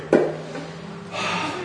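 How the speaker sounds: long unvoiced weary sigh; deep sigh. Preacher acting out Jesus' sigh at the Pharisees, a long weary exhale. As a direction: let out a heavy, tired breath. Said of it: "And he sighed deeply in his spirit".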